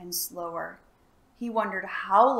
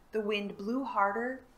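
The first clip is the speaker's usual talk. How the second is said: ordinary delivery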